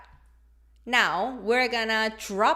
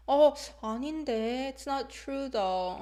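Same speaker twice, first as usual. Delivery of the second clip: disagree gently, trailing down; hesitant, non-confrontational; 4 semitones higher than usual. Shown as a demonstration. Teacher showing how falling intonation feels, hesitant. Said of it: "that's what it feels like".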